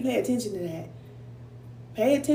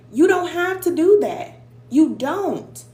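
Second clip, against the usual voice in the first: forceful insistence; 5 semitones higher, 6 dB louder, slower than usual. Real, not acted. Insisting on boundaries.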